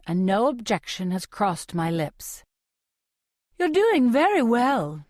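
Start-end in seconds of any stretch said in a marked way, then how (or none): 3.6-5.0 put-on higher, fussy Capitol accent; high sing-song Capitol accent. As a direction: Flavius coos praise in Capitol accent. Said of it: "says some guy named Flavius".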